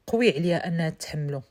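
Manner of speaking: usual speaking voice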